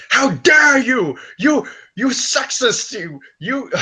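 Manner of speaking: high, drawn-out outraged screech; Zarna impression. Retelling a woman confronting Humongous, mock screech. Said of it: "that Zarna woman who's like".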